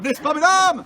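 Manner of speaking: loud call to the crowd. Real, not acted. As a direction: project it to the audience. Calling out to the audience.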